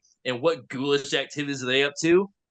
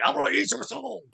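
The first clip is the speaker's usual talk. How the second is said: high, put-on creature voice; playful high-pitched demon voice; mock monster threat; 8 semitones higher than usual. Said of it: "And Carly Beth's like".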